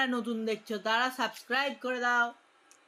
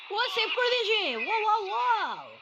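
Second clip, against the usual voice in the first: high surprised shout of disbelief; ooh, wow, a real shock; 11 semitones higher, 3 dB louder than usual. Real, not acted. Goalkeeper saves his shot.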